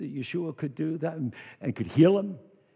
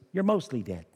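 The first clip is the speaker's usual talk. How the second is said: ordinary delivery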